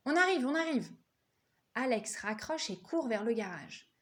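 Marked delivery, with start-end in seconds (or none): 0.1-0.9 urgent excited cry. Acted. Alex urgently answering phone news.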